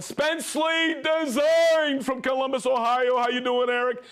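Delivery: high-pitched, rousing shout-out; calling out. Real, not acted. Host greets viewer Spensley Design from Columbus, calling out.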